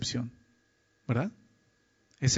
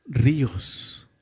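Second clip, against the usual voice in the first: ordinary talk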